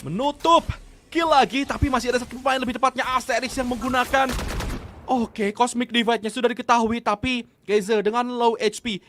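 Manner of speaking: loud hype esports call; fighting game announcer. Real, not acted. Hyped live play-by-play.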